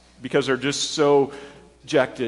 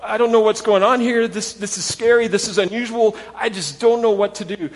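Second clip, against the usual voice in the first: higher, louder, anxious rush; frightened women; 6 semitones higher, 4 dB louder than usual. Retold or imitated. Pastor voicing the women scared at the tomb, higher and anxious. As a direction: voice the women wanting to run. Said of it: "they wanna go".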